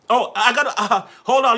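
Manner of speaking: ordinary talk, nothing marked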